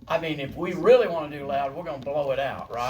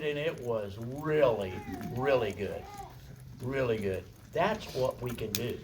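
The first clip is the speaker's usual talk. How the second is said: tender praise; 7 semitones lower, 7 dB quieter than usual. Real, not acted. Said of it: "soft praise".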